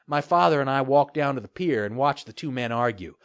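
usual speaking voice

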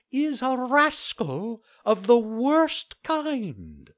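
a tiny insect denouncing a rascal; scolding cricket. Acted. Cricket condemns Pinocchio.

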